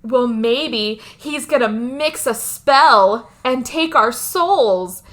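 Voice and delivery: slow, deliberately spaced-out mock delivery; drawn-out quote. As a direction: stretch out each phrase of the quoted line. Slowly quoting the dumb girl's odd line.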